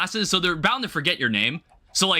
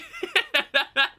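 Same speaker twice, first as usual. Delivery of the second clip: high-pitched laugh; 14 semitones higher than usual. Real, not acted. Bursts out laughing at game surprise.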